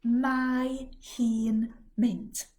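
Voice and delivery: mae hi'n mynd, spelled out very slowly. Shown as a demonstration. Teacher slowing a phrase for learners. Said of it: "She's going".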